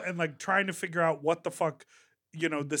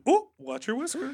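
quoted whiskers line; 9 semitones higher than usual. Acted. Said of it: "Maybe they said".

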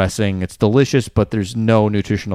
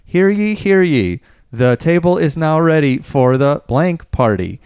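town crier; 5 semitones higher than usual. Retold or imitated. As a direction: announce it like a colonial herald. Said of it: "old colonial outfit comes up and goes".

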